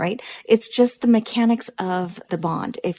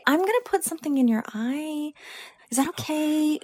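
higher, unsure permission-seeking; tentative asking; 7 semitones higher than usual. Shown as a demonstration. Lash tech showing the wrong, timid way to ask a client. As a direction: ask like you doubt yourself. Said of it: "No, don't do that".

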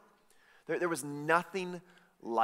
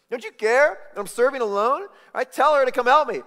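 loud, high, fed-up demand; ticked-off Martha; 11 semitones higher, 13 dB louder than usual. Retold or imitated. Martha, ticked at her sister, loudly demanding. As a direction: shout it at Jesus, fed up. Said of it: "she's ticked at Jesus".

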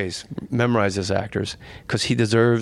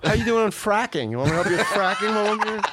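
high mock-helpful offer, quickening; sarcastic retort; 9 semitones higher than usual. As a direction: pile on fake eagerness to help, high-pitched. Acted sarcastic retort to canvasser.

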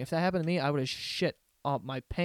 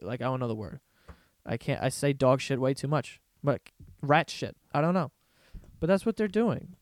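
ordinary delivery

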